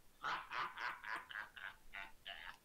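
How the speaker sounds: low, drawn-out villain chuckle; orc king's laugh. Acted. Orc king amused by an offered handshake, low chuckle. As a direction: chuckle low, in separate beats.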